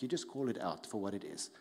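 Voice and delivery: ordinary talk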